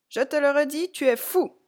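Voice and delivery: high, loud protest; cried out. A narrator's reading. Clown pelted with leaves cries out at Automne. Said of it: "s'écria le vieux clown".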